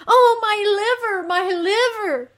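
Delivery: very high, loud wail; agonized moan. Acted. Underworld queen lamenting her aches, agonized moan. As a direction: cry out in exaggerated pain. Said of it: "she says".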